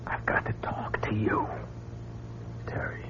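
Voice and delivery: hurried hushed plea; whisper, pulling her along; urgent whisper. Acted.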